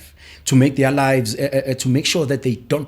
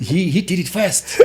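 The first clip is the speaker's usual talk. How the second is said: higher, louder indignant complaint with a laugh in it; mock protest; 7 semitones higher, 4 dB louder than usual. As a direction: protest like a wronged kid, half laughing. Retold protest to God.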